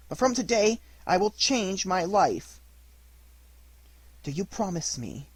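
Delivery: usual speaking voice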